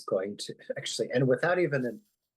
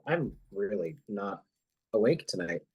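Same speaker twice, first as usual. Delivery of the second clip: ordinary talk, nothing marked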